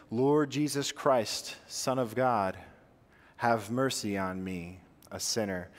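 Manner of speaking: slow, calm prayer, falling low and hushed; guided breath prayer. Shown as a demonstration. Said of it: "Let me be your, your voice in your head".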